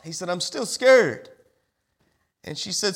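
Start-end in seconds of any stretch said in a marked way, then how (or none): 0.3-1.3 slow, higher, louder plaintive call; imitated scared child. Little boy upstairs to his mama, plaintive calling. Boy calls down that he's afraid.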